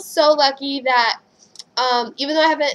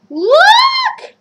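very high, loud cry of joy; excited shout; 11 dB louder than usual. Real, not acted. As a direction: shout to make viewers look. Showing off the gift.

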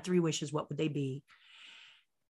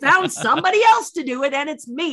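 high, loud, impatient retort; exasperated scolding; 5 semitones higher, 5 dB louder than usual. Retold or imitated. Stunt double sets the nervous star straight, exasperated and loud. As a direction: tell him off in mock exasperation.

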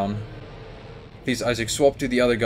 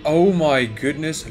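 normal talking voice

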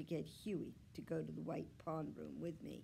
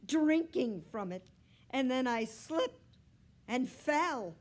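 loud, high, complaining cat; sustained high-pitched indignant yowl; 8 semitones higher, 9 dB louder than usual. A narrator's reading. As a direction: wail out your tale of woe. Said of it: "Are you ever a yowler?".

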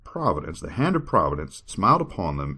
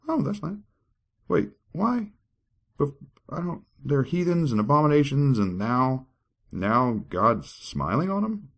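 surprised; 10 semitones higher than usual. Acted. Mock outrage at scripture.

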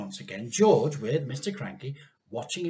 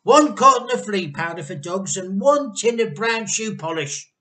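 excited; 6 semitones higher, 9 dB louder than usual. A narrator's reading. Mr Cranky reports excitedly.

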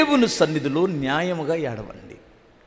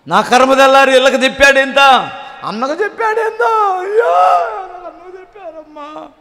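high, loud self-pitying wail; mock wailing; 8 semitones higher, 7 dB louder than usual. Caught sinner wailing about being told on, high mock wail. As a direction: cry out over being exposed. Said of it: "ఇది ఒక ఏడుపు".

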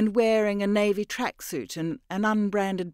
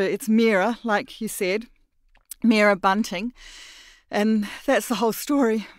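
higher and louder, pouring out; flustered confession; 5 semitones higher, 6 dB louder than usual. Acted. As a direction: blurt out the truth in fear. Mira confesses.

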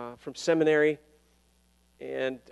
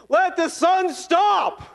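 slow, high proclaiming shout; booming mock decree to the sun; commanding shout; 7 dB louder, much slower than usual. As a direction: command the sun to stand still. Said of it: "I am gonna say".

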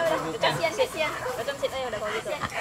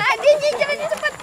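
excited call to dog; 8 semitones higher, 7 dB louder than usual. Real, not acted. Dog pulling hard on walk.

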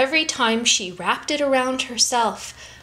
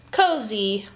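plain reading voice

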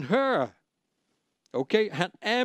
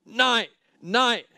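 imiteret råben i højere toneleje; gentagne råb; 5 semitones higher, much slower than usual. Retold or imitated. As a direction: efterlign mandens stædige afvisninger. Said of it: "og han råbte". (genfortalt, gentaget råb)

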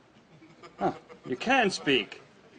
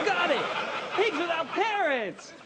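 higher, laughing triumph at solving the clue; excited breakthrough; 11 semitones higher than usual. Acted. Detective guesses the charity and laughs in triumph. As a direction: burst out with the answer, laughing.

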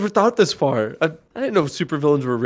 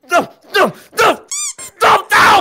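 mock panic; 13 semitones higher, 4 dB louder than usual. Hero failing mid-rescue.

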